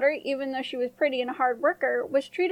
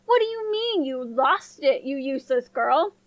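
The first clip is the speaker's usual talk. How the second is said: scolding stepmother. A narrator's reading. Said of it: "she yelled".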